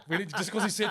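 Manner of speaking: usual speaking voice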